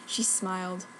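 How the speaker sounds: ordinary delivery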